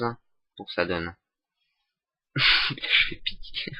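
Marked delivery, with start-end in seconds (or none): 1.9-3.8 breathy, voiceless giggle through the words; laughing. Real, not acted. Tutorial host laughs at his own fumble, laughing. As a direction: say it through helpless self-mocking laughter.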